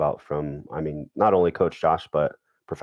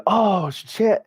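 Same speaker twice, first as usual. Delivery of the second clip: loud, high yelp of alarm; retold shocked realisation; 5 dB louder than usual. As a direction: act out dad's sudden horrified discovery. Dad looks down, realises, runs off.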